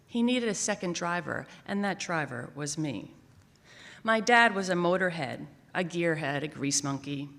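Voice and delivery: ordinary delivery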